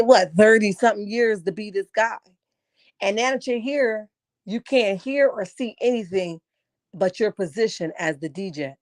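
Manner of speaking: normal talking voice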